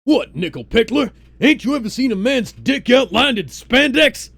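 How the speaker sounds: loud, high mocking taunt; T'Challa voice. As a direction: bellow the jab like a cocky king. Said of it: "T'Challa asked".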